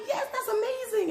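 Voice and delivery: excited squeal. Retold or imitated. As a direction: squeal it with delight. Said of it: "And I'm like".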